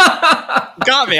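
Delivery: breaking up with laughter; laughing. Real, not acted.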